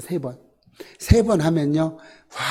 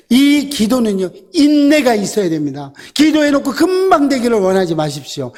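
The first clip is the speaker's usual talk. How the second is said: loud, higher fervent exhortation; full-force preaching; 7 semitones higher, 4 dB louder than usual. Acted. Preacher exhorting congregation on patient prayer, fervent. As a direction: press the congregation hard.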